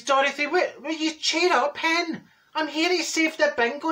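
high-pitched mock scolding; exasperated chivvying. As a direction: chivvy a glum friend along. Telling glum Dorothy to cheer up.

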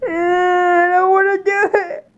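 high, whimpering nerves; stage fright. Real, not acted. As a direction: whine it, close to tears. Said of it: "I'm so nervous".

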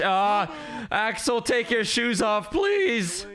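pleading voice for a character. Acted.

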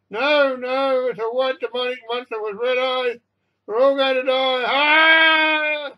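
high, loud, drawn-out wailing; hysterical panic. A narrator's reading. Passengers pleading to heaven over a monster, hysterical wailing. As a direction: wail and pray as if doomed.

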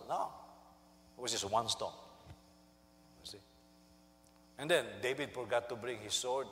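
normal talking voice

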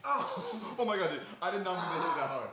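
loud laughing apology. Real, not acted. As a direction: laugh out, startled. Mask slapped on face.

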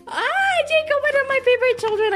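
high, loud, fast cooing squeal; gushing greeting. Acted. Doll mother to favorite child Jacob, gushing greeting. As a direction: coo rapidly in a high squeal.

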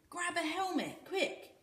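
put-on high, eager boy's voice; excited. A narrator's reading. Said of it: "said Volga excitedly".

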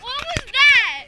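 high, loud, slow disbelief; surprised. Real, not acted. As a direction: drag it out in shock. Reacting to friend's dance.

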